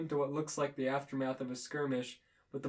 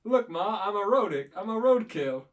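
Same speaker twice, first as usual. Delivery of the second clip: higher, louder goofy boast to camera; cartoon character voice; 5 semitones higher, 7 dB louder than usual. A narrator's reading. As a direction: show off like a flattened cartoon. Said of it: "looks in to another pretend camera and says".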